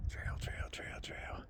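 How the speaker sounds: whispered to himself. Real, not acted. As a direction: whisper it while searching. Searching for the trail alone.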